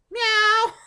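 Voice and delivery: high, loud meow; a loud high cat cry. Retold or imitated. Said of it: "little paw through the crack".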